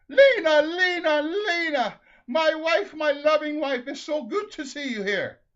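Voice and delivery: very high, loud cries of greeting; overjoyed shout. Acted. Ole reunites with his wife at pearly gates, overjoyed shout. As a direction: call out to her in exuberant delight.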